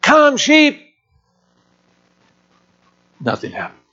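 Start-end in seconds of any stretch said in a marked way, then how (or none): 0.0-0.9 high, hollered sheep call; boy's high-pitched holler up a hillside; young boy's yell. Retold or imitated. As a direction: imitate an eight-year-old calling the flock. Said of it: "loud voice, he yelled".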